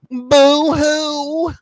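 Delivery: high, loud mock sob; mocking boo-hoo. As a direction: sneer it like a fake cry. Mocking crying.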